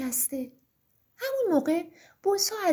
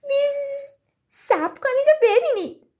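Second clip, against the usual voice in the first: buzzing bee character; 8 semitones higher, 5 dB louder than usual. A narrator's reading. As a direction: buzz first, then pipe up boldly. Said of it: "زنبور گفت".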